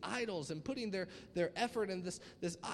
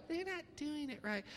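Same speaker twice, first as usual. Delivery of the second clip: high-pitched mock tattletale; imitated tattletale whine; 7 semitones higher, 3 dB quieter than usual. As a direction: tell on them like a little snitch. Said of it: "these little tattletales are like".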